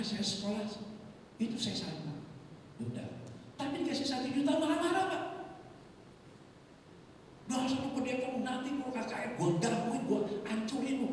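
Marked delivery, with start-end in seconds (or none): 3.5-5.2 high-pitched, aggrieved protest; indignant complaint. Acted. Complains of angry man despite payment. 7.5-11.1 high-pitched angry tirade; acted threat. Retold threats from angry man.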